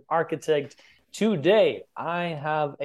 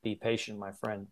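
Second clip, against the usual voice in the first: soft reassurance; 4 semitones lower, 8 dB quieter than usual. Acted. Soft aside after imagined objection.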